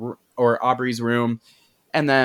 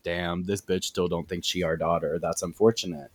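deep sarcastic mutter; low mocking voice; 7 semitones lower, 5 dB quieter than usual. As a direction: drop low and deadpan it. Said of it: "And they're like".

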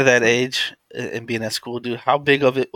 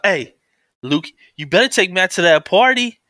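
loud, higher mom voice; bossy mom; 8 semitones higher, 5 dB louder than usual. Retold or imitated. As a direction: nag him like a mom. Mom voiced telling son.

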